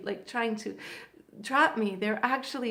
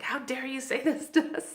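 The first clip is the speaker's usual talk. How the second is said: higher-pitched mock outrage, half laughing; mock indignant; 4 semitones higher than usual. Men upset by her remark, mock indignant. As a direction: imitate the offended guys in playful protest. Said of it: "they're all getting upset".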